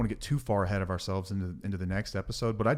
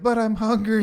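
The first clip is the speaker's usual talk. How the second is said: put-on high, louder childish whine; whiny little kid; 12 semitones higher, 9 dB louder than usual. Host mimicking a small child interrupting a parent, high childish whine. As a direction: pipe up like a hungry little boy. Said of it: "little Johnny's like".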